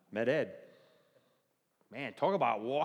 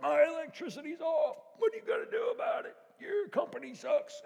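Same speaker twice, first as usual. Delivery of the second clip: high, loud mock rant at the repairman; hammed-up shouting customer; angry customer; 6 dB louder than usual. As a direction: play the furious customer stomping in the mud.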